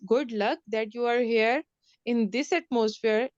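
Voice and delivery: ordinary talk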